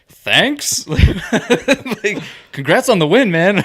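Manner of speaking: hammed-up awkward thank you. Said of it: "I was just like".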